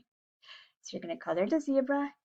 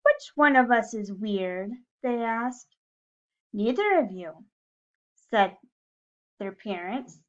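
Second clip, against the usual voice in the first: lively, louder storybook reading; reading to children; 2 semitones higher, 6 dB louder, slower than usual. A narrator's reading. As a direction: read it like story time for little kids. Reading a picture book to class.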